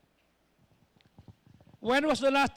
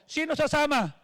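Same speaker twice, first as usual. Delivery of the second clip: calling out; 4 semitones higher, 7 dB louder than usual. Retold or imitated. Pastor calls out to the group.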